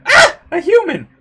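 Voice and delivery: high, loud yelp; startled alarm. Acted. Creature startled by a human, shrieking alarm. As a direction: shriek in alarm, pitch leaping up.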